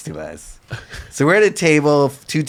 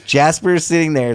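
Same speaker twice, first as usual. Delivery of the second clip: ordinary talk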